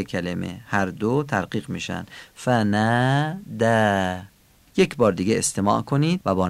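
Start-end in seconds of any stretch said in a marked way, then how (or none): 2.3-4.2 فناده very slowly, long alefs pulled toward e; thin alef. Shown as a demonstration.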